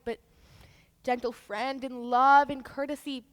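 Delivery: ordinary talk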